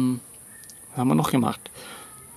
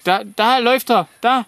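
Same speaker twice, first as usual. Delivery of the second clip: calling out, pitched up and louder; yelling across the room; 10 semitones higher, 4 dB louder than usual. Real, not acted. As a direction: shout it across to someone far away. Pointing out child to neighbour kid.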